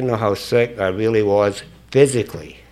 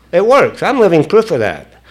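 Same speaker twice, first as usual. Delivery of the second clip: higher, punchy "I'm living proof"; emphatic testifying; 4 semitones higher, 3 dB louder than usual. Real, not acted. Speaker testifying that the program works, emphatic. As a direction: declare "I'm living proof" with force and raised pitch. Testifies, then turns it on listeners.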